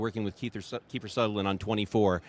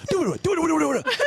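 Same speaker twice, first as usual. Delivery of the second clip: much higher, louder rapid-fire mock babble; rushed squeaky gabble; 5 dB louder, faster than usual. Mimicking his own attempt to keep up with Kiefer, in a squeaky rush. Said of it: "And I said".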